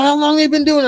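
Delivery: high, loud angry demand; angry yelling. Retold or imitated. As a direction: keep yelling, still furious. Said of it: "He's like".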